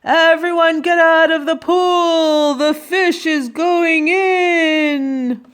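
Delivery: firemen's yell. A narrator's reading. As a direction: shout a long warning to everyone. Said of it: "the firemen yelled".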